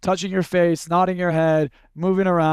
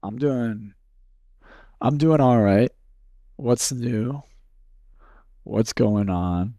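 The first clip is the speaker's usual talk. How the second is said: lower, slow, dragged-out reply; slowed-down tempo; 8 semitones lower, much slower than usual. Shown as a demonstration. Coach showing a student slow tempo, slow and low. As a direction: stretch every word out, slow and low. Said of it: "Tone, tempo".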